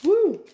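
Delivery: high loud yelp; sour yelp. Real, not acted. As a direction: let out a sharp high woo.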